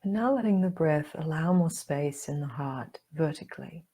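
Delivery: calm meditation guide with long pauses; guided meditation. Acted. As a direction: guide them gently inward, leaving room to breathe.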